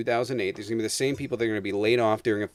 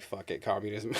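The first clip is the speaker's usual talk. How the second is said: muttered, softer, resigned shrug of a line; resigned mock give-up; 4 semitones lower, 8 dB quieter than usual. Fed up with free market people, a quiet resigned shrug. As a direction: toss it off like giving up on them.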